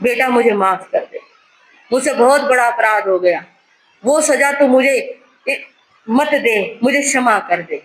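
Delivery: normal talking voice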